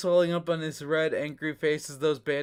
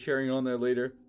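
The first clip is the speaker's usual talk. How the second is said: ordinary talk, nothing marked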